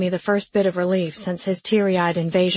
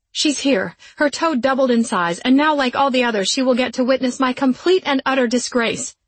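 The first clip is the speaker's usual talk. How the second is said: loud, higher indignant rant; angry; 5 semitones higher, 4 dB louder than usual. A narrator's reading. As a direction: rant about the disgrace.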